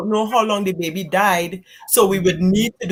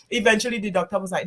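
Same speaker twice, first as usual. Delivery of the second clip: ordinary talk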